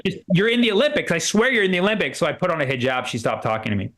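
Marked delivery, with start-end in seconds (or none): 0.0-2.2 insistent woman. Retold or imitated. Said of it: "She's like".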